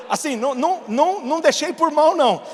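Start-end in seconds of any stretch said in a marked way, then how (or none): none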